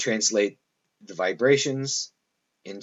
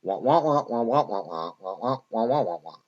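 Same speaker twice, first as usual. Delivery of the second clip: muffled wah-wah imitating the grown-ups in Charlie Brown; Peanuts adult voice. Lecturer mimics how Charlie Brown adults sound, muffled wah-wah. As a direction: mimic a trombone-like teacher voice, lightly. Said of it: "they always sound like this".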